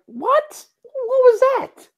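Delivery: high confused squeak, slow on what was that; baffled what. Retold or imitated. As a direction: fans hearing the call, squeaking in confusion. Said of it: "everyone was like".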